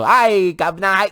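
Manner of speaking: high, drawn-out mock Cockney greeting; Cockney accent. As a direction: call it out in a hammed-up Cockney. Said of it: "spoke with a Cockney accent".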